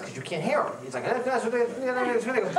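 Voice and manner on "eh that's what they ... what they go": lightly voiced garbled fast mumble, high and laughing; mumbling Barry. Barry talking too fast to follow, mock mumble with a laugh. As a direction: mash the words like a fast mumbler. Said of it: "sounds like Gaelic when he talks fast".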